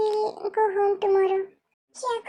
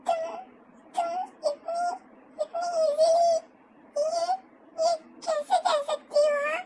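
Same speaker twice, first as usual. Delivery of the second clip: crying; 4 semitones higher than usual. Acted. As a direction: voice breaking, higher, laughing through tears. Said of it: "क्या तुम रो रहे हो?".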